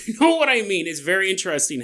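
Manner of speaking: ordinary talk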